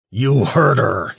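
loud, high, slow snarl; slow growl. A narrator's reading. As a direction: spit it out slowly in rage. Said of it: "he growled".

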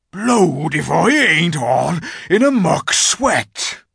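high, loud, slow country voice; puffed rustic giant. A narrator's reading. Giant has just smashed the gates, puffing loudly. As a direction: heave it out like a giant after hard labour. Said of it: "puffing like the largest railway engine".

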